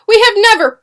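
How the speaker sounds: high, loud protest, cut short; shrieked protest. A narrator's reading. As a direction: cry out until interrupted. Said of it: "Susanna shrieked".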